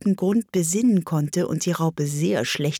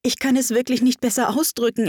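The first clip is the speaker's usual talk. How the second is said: quick, higher-pitched polite reply; 6 semitones higher, faster than usual. A narrator's reading. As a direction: excuse yourself courteously and quickly. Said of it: "erwiderte Alice sehr höflich".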